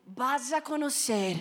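slow, low and breathy mock-solemn; hushed dramatic reveal. Mother unveiling waxing to her daughter, hushed dramatic reveal. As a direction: whisper it like a grand secret.

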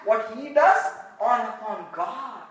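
ordinary talk, nothing marked

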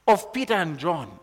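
ordinary talk, nothing marked

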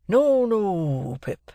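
lightly voiced blacksmith Joe, slow and kindly; a hint of a simple country smith. A narrator's reading. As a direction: ease into it like gentle old Joe. Joe voiced in read novel.

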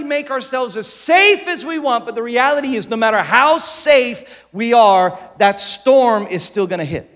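ordinary talk, nothing marked

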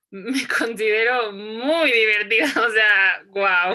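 loud, amused self-praise; laughing. Real, not acted. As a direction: burst out laughing at your own boast. Laughs at calling herself fun.

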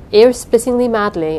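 ordinary delivery, nothing marked

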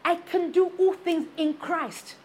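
slow, weighty, stressing all; slow, emphatic scripture reading; slow deliberate quote. Shown as a demonstration. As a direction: lay each word down firmly. Full verse, slowed.

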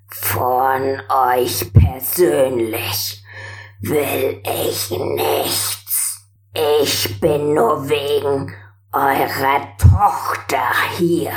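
put-on very old man, croaking and slow. A narrator's reading. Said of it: "Mit krächzender Stimme sprach er".

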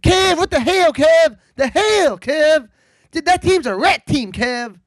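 mildly hyped radio-guy griping at Kev; light impression of a podcaster ranting to Kev; Chris Vernon impression. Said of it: "if this sounds like Chris Vernon".

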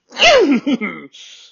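loud, hacking real cough; throat-clearing cough. Real, not acted. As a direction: cough hard to shift the phlegm. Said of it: "get it all out".

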